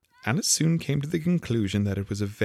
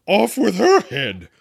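high, loud, drawn-out sentence; furious shout; 14 semitones higher, 9 dB louder, slower than usual. A narrator's reading. Queen in a passion condemns players, shouting. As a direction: shout it in a royal rage. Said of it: "stamping about and shouting".

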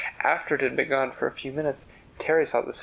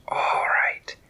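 breathy hissed snake voice, a little distant; whispery snake. Acted. Snake agreeing to leave Terry's ladder, in a whispery hiss. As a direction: let the snake sigh it out in a hiss.

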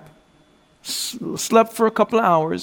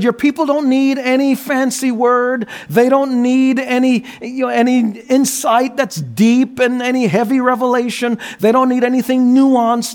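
louder and higher, driving the point home; full-force preaching; 6 semitones higher, 4 dB louder than usual. Acted. Preacher urging a pastor to preach, loud and fervent. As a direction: build it up like a pulpit climax.

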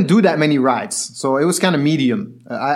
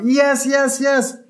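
high, celebrating cries; thrilled aunt; 10 semitones higher, slower than usual. Retold or imitated. Aunt hugging her nephew after karting, elated. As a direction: act out her overjoyed yeses. Aunt's thrilled hug, acted out.

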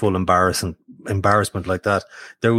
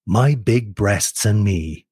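promo announcer. Retold or imitated. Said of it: "my male voice".

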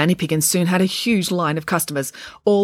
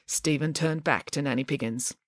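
plain reading voice

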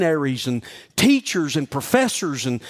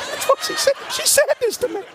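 incredulous high squeal. Real, not acted. Preacher amused at the insult.

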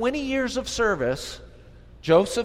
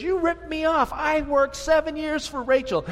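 indignant complaint; 10 semitones higher than usual. Retold or imitated. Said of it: "he talks to his uncle and he says".

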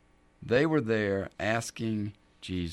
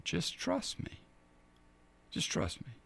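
much softer, breathy whisper; whispered plea; 6 semitones higher, 10 dB quieter than usual. Acted. Jesus urging the father to trust, whispered and intimate. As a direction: lean in and whisper the reassurance.

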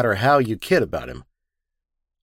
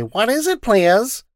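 much higher, lightly voiced receptionist; high, rising what is it please; receptionist's question; 12 semitones higher, 3 dB louder, slower than usual. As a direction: pop it up high like a startled secretary. Receptionist challenges him.

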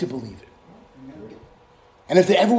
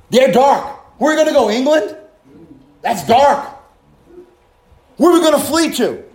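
higher and louder, building to a shout; preaching at full force; 4 semitones higher, 3 dB louder than usual. Acted. Preacher warning there is nowhere to flee, building shout. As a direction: hammer the question home.

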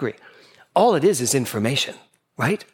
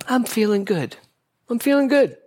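sing-song "I'm feeling good"; 8 semitones higher than usual. Retold or imitated. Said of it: "It's usually good".

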